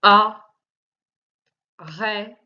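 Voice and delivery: "Arrête" as a, rrê, te with long pauses; split into syllables. Shown as a demonstration. Teacher showing which syllable holds the sound, split into syllables. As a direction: break "Arrête" into three beats, final e sounded. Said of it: "Deuxième syllabe".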